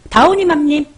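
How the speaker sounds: high, loud call of a viewer's name. Real, not acted. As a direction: call out to her over the crowd. (높고 큰 소리로 이름 부르기)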